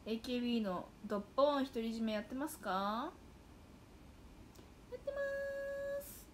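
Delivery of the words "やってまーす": much higher, slow, sing-song "やってまーす"; chirpy audience reply. Pretend. Voicing the fans answering her question, in a cute high sing-song.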